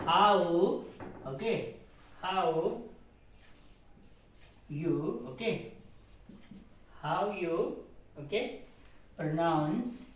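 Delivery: teacher dictating each word with long pauses; word-by-word dictation. Shown as a demonstration. Teacher dictating slowly to the class. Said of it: "How you pronounce this word".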